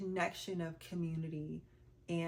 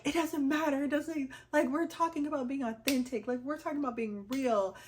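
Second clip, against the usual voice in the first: emphatic cousin; 7 semitones higher, 6 dB louder than usual. Retold or imitated. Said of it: "she was like".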